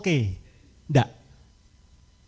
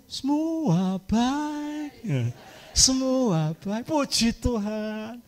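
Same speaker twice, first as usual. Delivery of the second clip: fake cheerful; 4 semitones higher, 4 dB quieter, much slower than usual. Mother pretending all is fine.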